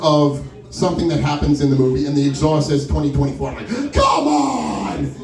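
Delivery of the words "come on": exasperated yell at fate; mock exasperation. Retold exasperation at omen.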